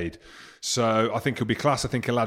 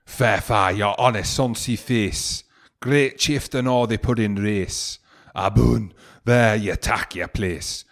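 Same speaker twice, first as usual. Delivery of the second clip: slow, hammed-up Scottish accent for Burns; much slower than usual. Reading Address to a Haggis to podcast listeners, in a put-on Scottish accent. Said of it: "A nice accent as well that you put on there".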